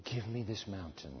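low, soft pleading prayer; hushed low prayer. Shown as a demonstration. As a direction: pray it quietly and low. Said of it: "ask God for the mountain".

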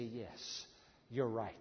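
very soft, low and slow; hushed compliance. Acted. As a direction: sink down into quiet agreement. Models submissive answer to the whisperer.

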